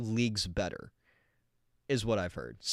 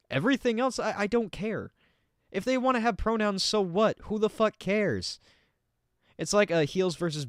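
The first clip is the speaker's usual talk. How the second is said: exasperated; 10 semitones higher than usual. Real, not acted. Exasperated rant about pronouns.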